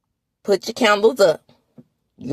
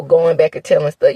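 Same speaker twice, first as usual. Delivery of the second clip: normal talking voice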